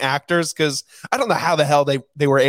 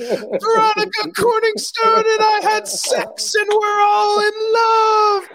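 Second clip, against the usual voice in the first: much higher, slow, hammed-up wailing shout; imitated shouted confession; slower than usual. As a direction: belt it out like a man who can't hold it in. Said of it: "And then it cuts to Ron".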